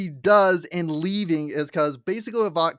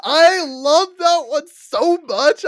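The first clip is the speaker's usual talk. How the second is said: much higher, louder and slower gush; 11 semitones higher, 10 dB louder, slower than usual. Real, not acted. Gushing to cohost about Scanlan's roast, excited. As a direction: rave about the scene. Gushing over favourite scene.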